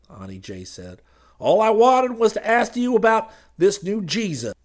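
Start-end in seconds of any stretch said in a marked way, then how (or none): 1.4-4.5 loud, high glad-handing preacher voice; high preacher figure. Acted. Street preacher pitching to the crowd, loud and high. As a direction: boom it out like a street preacher selling salvation.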